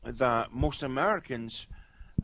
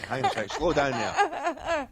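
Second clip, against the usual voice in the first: slower, higher mock plea; lightly acted, high-pitched interruption; mock overwhelmed listener; 11 semitones higher, 4 dB louder, slower than usual. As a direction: play someone begging fast talkers to ease up. Voicing bewildered listener to fast Spanish.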